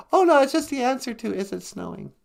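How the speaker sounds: high partner voice. Acted. High puppet-like partner voice answering.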